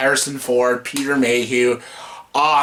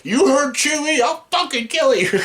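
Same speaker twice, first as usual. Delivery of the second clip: higher, pushed threat to Finn; mock threat; 10 semitones higher than usual. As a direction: threaten him, half joking. Quoting Han threatening Finn.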